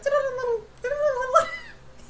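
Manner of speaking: high warbling mock turkey call; imitated creature noise. Viewer mimicking the eerie monster noise, high gobbling call. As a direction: gobble it out like a strange animal in the woods. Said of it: "it sounded like turkeys or something".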